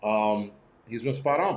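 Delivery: normal talking voice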